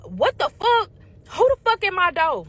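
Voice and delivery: loud, high-pitched angry outburst; pissed off. Retold or imitated. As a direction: yell it at the closed door, pissed. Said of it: "So I'm pissed".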